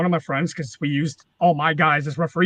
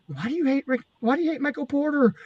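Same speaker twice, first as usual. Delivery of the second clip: small, raised mock-kid voice; kids' voices; 6 semitones higher than usual. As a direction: imitate pleading young fans in a small, high voice. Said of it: "kids would come up".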